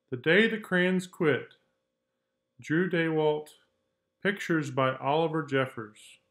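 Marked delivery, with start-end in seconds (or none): none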